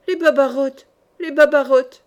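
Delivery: loud, repeated alarm call; cry of distress. A narrator's reading. Old servant finding cockroaches in her kitchen, cry of distress. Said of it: "poussa un cri de détresse".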